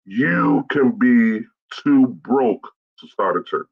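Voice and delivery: slow, drawn-out YOU and BROKE; deliberate, weighty announcement of a key point; emphatic proclamation. Real, not acted. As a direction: stretch out "too broke" like a headline. Announces his key first comment.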